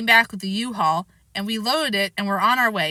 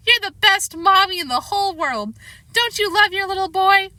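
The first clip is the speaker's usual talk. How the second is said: put-on high wheedling child voice; 10 semitones higher, 4 dB louder than usual. Boy wheedles his mother, high sweet voice. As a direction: flatter her with a sugary voice. Fudge flattering Mom.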